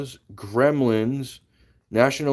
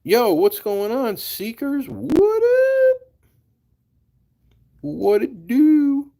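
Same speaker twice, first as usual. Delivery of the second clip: high, loud hype-man greeting; hyped greeting; 5 dB louder, much slower than usual. Real, not acted. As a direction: pump up the viewers.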